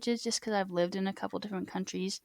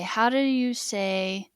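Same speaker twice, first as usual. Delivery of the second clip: slow learner question; much slower than usual. Shown as a demonstration. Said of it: "if I say".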